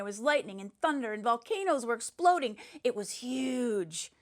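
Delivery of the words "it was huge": dramatic slow. Acted. Boy boasts about the battle.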